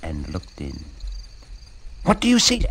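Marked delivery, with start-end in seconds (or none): 2.0-2.6 eager rooster. Acted.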